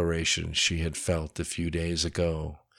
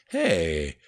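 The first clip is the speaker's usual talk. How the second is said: defensive; 5 semitones higher, much slower than usual. A narrator's reading. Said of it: "he defended himself".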